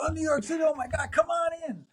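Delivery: high squealing welcome from an impressed engineer; Nigel thrilled. Retold or imitated. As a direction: imitate Nigel gushing with excitement. Nigel reacts to New York.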